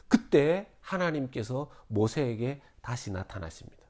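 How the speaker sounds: normal talking voice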